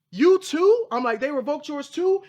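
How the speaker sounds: high, loud "You too?" in astonishment; stunned to learn others were hit too; shocked disbelief. Retold or imitated. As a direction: gasp it out, can't believe it. Learns others were also revoked.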